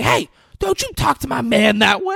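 high, loud, hammed-up sass; mock sassy defender. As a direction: stick up for her man, overdone. Patricia Arquette defending Maurice.

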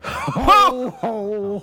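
loud high Santa laugh; jolly ho ho ho. Pretend. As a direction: boom it out like Santa. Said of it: "give me your ho, ho, ho's".

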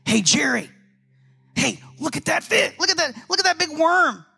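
a fish gawking at bait, egging on his buddy; hint of a goofy cartoon fish. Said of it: "this fish is like".

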